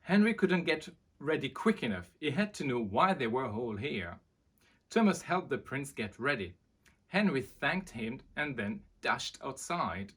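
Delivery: lively read-aloud voice; storybook narration. A narrator's reading. Reading a children's book aloud to viewers, lively. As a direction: tell it like bedtime to children.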